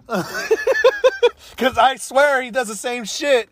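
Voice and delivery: slow, high, laughing through it. Real, not acted. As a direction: crack up while saying it. Laughing while making the point.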